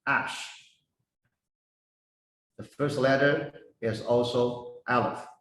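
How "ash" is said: ash said softly, ahsh; pronounced ash. Shown as a demonstration. Teacher gives the Hebrew for fire, a soft ahsh. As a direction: breathe out ash quietly.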